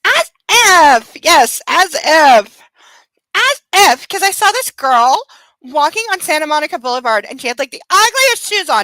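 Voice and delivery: put-on high Valley Girl gossip; Valley Girl, scandalized. Valley Girl gossiping about the ugliest shoes, scandalized.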